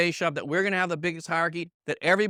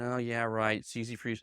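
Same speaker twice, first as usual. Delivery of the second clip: mock sceptic; 6 semitones lower, 6 dB quieter than usual. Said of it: "some of you are going".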